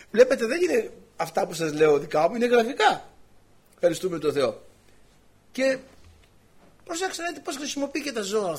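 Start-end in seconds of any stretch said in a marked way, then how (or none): none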